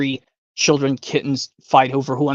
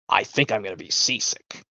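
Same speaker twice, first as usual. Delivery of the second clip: lower, softer queasy moan; queasy guest; 6 semitones lower, 6 dB quieter than usual. Retold or imitated. A seasick guest on the boat, lower and softer. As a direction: mumble it like your stomach turns. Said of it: "from Willy Wonka".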